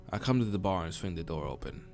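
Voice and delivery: ordinary talk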